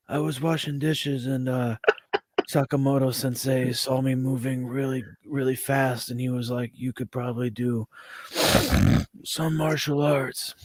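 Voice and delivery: a hint of a soft-spoken action star; lightly voiced Seagal murmur; Steven Seagal impression. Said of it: "my best Steven Seagal impression".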